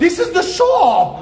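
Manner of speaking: high, drawn-out excited shout; real excited exclamation. Real, not acted. Storyteller telling his companion this is the shop, excitedly. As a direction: call it out high and slow, thrilled to be proven right.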